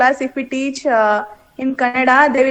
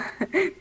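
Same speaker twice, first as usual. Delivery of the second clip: brief embarrassed chuckle; a quick awkward laugh; short nervous laugh; 5 semitones higher, 11 dB quieter than usual. Real, not acted. Awkward answer to teacher.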